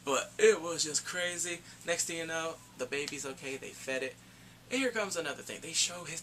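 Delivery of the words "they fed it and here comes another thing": ordinary delivery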